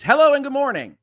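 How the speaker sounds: high, loud, chipper show greeting; bright host greeting. Retold or imitated. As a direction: sing out a cheery good morning. Cheery on-air greeting.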